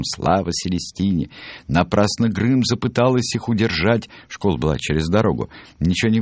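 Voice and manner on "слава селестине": plain reading voice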